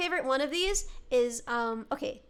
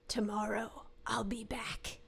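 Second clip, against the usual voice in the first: low, slow witch whisper; 6 semitones lower, 12 dB quieter, slower than usual. Acted. Witch promising the kid she will return, menacing whisper. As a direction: whisper it slowly as a creepy threat. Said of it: "That's a good witch voice".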